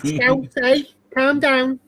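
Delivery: playful Scouse impression; mock Scouse accent. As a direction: tease him with a Liverpool Scouse accent. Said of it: "you're not Scouse".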